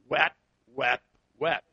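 short, low, separate whaps; three dropped, evenly spaced noise imitations; imitated noise. Husband imitating what his wife started in the car, short low whaps.

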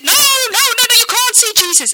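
high, loud, urgent no no no; alarmed disciples shouting. Acted. The disciples turning the crowd away, shouting high and urgent. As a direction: shoo the crowd away in a panic.

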